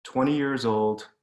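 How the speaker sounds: plain reading voice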